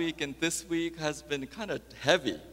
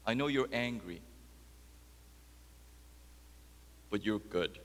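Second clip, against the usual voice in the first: gentle reassurance; 8 dB quieter than usual. Acted. God answering a hurting doubter, gentle reassurance. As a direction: soothe gently, with long pauses.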